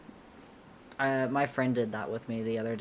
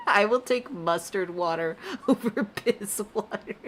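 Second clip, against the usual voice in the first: high-pitched, laughing through every word; can barely get words out for laughing; helpless laughter; 10 semitones higher, 3 dB louder than usual. Real, not acted. Streamer cracking up at chat's Monster Energy piss water, laughing high. Chat keeps posting cursed water.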